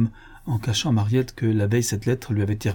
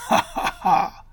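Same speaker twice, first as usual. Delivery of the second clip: high, acted mocking cackle; sardonic laugh; 9 semitones higher, slower than usual. A narrator's reading. Madame Lacombe scoffing at the claimed fortune, sardonic laugh. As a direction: burst into scornful laughter. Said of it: "avec un éclat de rire sardonique".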